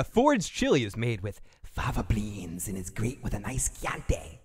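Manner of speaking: low, sinister Lecter voice ending in a whisper; a hint of Hannibal Lecter, dropping to a whisper. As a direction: purr it like a cannibal savouring dinner. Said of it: "trying to do a Hannibal Lecter".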